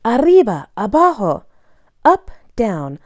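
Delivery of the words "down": usual speaking voice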